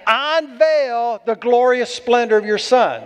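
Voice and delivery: "Unveil" stretched out slow and stressed; slow, weighty emphasis starting on "Unveil"; emphatic repeat. Shown as a demonstration. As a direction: lean hard on "Unveil", drawing it out. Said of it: "You get the emphasis on this?".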